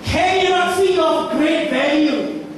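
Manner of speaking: high shouted sermon plea; full preaching. Acted. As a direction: shout the hope to the room.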